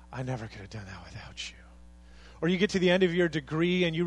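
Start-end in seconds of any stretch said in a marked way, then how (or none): 0.1-1.6 grateful thanks. Retold or imitated. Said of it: "you look and you just say".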